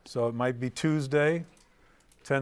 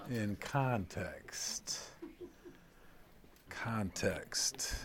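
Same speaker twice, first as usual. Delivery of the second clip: "context" said very slow and softer, set apart; slow emphasis on context; 3 semitones lower, 10 dB quieter, much slower than usual. Shown as a demonstration. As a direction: land the word "context" deliberately. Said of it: "stressing the key word".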